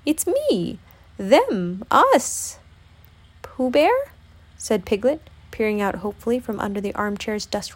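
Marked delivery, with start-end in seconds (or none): 0.1-2.6 cartoon bear, pitch swooping up; halting answer in a bear's voice; Pooh. A narrator's reading. Pooh answering Piglet through the door, in a Poohish voice. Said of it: "responded a very poohish-sounding voice". 3.4-4.1 high, rising, hopeful question; timid Piglet. A narrator's reading. Piglet peering out from under the armchair, hopeful.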